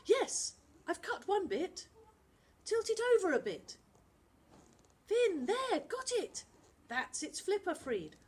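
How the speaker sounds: put-on high, urgent boy working fast; a boy calling out while cutting string free; urgent child voice. Boy to friends in the boat, high and urgent. Kids freeing a tangled dolphin.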